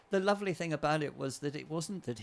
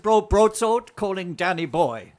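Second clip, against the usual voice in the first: louder, higher, slow film quote; insistent repeated call; 6 semitones higher, 13 dB louder, slower than usual. Retold or imitated. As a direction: belt out the line for someone who missed it. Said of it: "I said".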